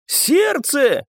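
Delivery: high-pitched urgent call; crying out. A narrator's reading. Onlooker to the fighters, crying out. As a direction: call it out from the crowd. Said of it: "крикнул кто-то из толпы".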